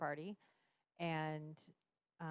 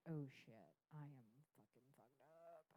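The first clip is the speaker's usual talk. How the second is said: inner thought; 3 semitones lower, 16 dB quieter than usual. Retold or imitated. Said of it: "And I thought".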